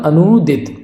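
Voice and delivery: ordinary talk, nothing marked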